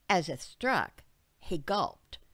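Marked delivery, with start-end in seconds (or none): none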